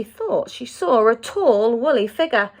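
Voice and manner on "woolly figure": plain reading voice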